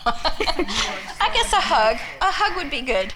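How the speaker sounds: laughing. Real, not acted. Shy laugh after sharing.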